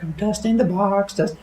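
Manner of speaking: high, light put-on voice; dusting singsong. Wife dusting the mysterious box, light high singsong. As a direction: chirp it lightly as you work.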